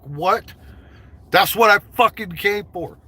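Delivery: high, loud incredulous outburst; mock indignation. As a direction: act out being loudly cheated and indignant. Acts out his indignant reaction.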